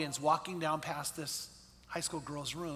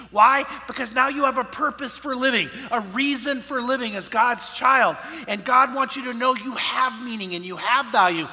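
loud, raised, emphatic sermon delivery; preaching; 4 semitones higher, 7 dB louder than usual. Real, not acted. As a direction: drive each point home to the congregation. Preaches at full force.

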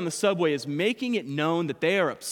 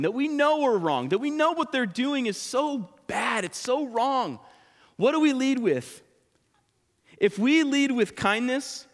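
higher, insistent preacher voice, pressing each question; preaching at full force; 6 semitones higher, 4 dB louder than usual. Acted. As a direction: drive the point home to the congregation.

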